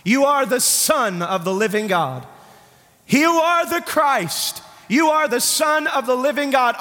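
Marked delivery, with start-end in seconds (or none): 3.1-6.8 soaring preached declaration. Shown as a demonstration. Preacher repeating Peter's words to the church, soaring. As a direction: lift it up like a revelation. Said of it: "repeated, preached".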